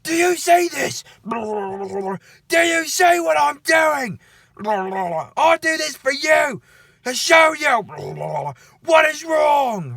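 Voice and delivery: put-on guard imagined ranting at kids while drowning one, crazed slow shout. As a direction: scream it slowly like a killer making an example.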